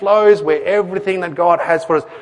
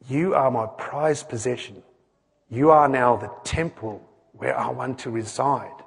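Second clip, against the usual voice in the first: soft, measured voice of a loving Father; God the Father; 4 semitones lower, 6 dB quieter than usual. Acted. God tells his people they are loved, tender Father. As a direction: speak tenderly and quietly, voice lowered. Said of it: "God the Father is saying to us".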